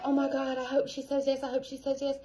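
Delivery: soft, tense, pleading murmur of hope; anxious hoping. Retold or imitated. Coach to her team, imitating a nervous seller in a soft anxious plea. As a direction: quietly beg the universe for a yes. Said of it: "Versus if I'm like".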